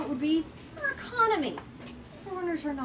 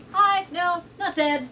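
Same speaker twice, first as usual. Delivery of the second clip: a bright, louder mock hello from a lost explorer; cheery returning explorer; 5 semitones higher, 5 dB louder, slower than usual. Explorer back after twenty years, cheery mock greeting. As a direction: pop back in like nothing happened. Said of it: "they pop back up like".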